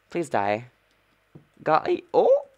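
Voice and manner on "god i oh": loud yelp leaping very high; startled, high, cracking outburst; near voice crack. Real, not acted. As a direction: let the voice nearly crack upward. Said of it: "I almost had a voice crack".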